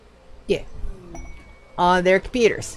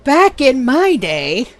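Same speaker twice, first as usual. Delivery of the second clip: slow, drawn-out, loud "back in my day"; put-on old-timer reminiscing; 5 semitones higher, 5 dB louder, slower than usual. Streamer opens a rant on old gaming days, hammed-up old-timer. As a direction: stretch it out with mock old-timer weight. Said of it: "all of the things in my voice".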